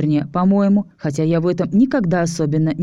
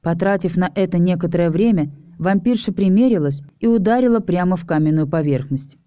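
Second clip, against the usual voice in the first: usual speaking voice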